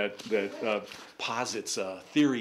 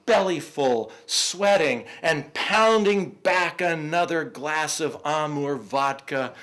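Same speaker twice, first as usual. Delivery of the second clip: rising, louder poetry recital voice; poem reading; 5 semitones higher, 7 dB louder, slower than usual. Acted. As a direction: read it like a dramatic poem. Reads poem aloud.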